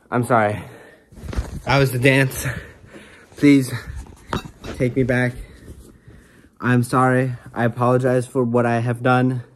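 loud, hammed-up declaration of love; mock passionate apology. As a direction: belt out the apology like a soap hero. Melodramatic shouted apology after dance.

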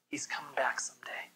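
low hushed whisper of hope; whispered promise. Real, not acted. Preacher telling the congregation Christ returns, in a hushed whisper. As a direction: lean in and whisper it.

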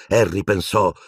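usual speaking voice

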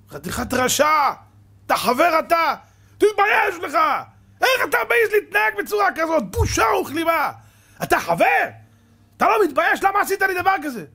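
high, loud outraged shouting; outraged friend shouting; furious rebuke. Acted. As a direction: scold him for shaming you. Betrayed friend rebukes him.

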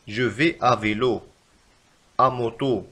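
each word spoken out slowly; slow teacher example. Shown as a demonstration. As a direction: read it slowly and clearly for the class. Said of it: "مثلًا". (مثال بطيء وواضح للمتعلمين)

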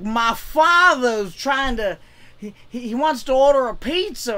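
put-on slow, loud Italian-American New York accent; Sebastian Maniscalco impression. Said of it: "like Sebastian Maniscalco is like".

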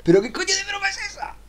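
sudden high-pitched disbelief, shocked; incredulous outburst. Real, not acted. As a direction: react as if the joke is outrageous. Outraged at the prank idea. (exclamación incrédula y aguda)

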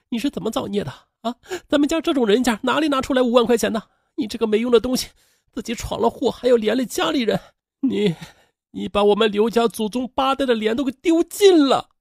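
high-pitched exasperated telling-off; scolding. A narrator's reading. Sister berating her brother for shaming the family, scolding. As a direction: berate him in a put-on woman's voice. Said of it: "一边训斥着刘玉奎".

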